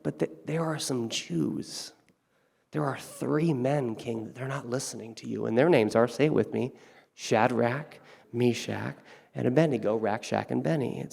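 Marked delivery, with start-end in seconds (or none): 0.0-5.4 sneaky tattling. Pretend. Courtiers secretly telling on the three men, hushed. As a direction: lean in and snitch quietly.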